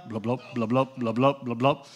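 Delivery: thump out the blubs like a tire flopping on the ground; flat-tire tractor sound. Retold or imitated. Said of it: "What kind of sound the tractor gonna make".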